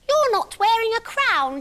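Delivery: put-on high, loud child's voice; a cheeky six-year-old pointing it out; squeaky kid voice; bratty little girl. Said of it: "and she said".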